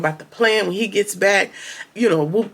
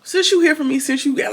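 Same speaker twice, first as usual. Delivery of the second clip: mock taunt; 8 semitones higher than usual. Mother taunts the young man.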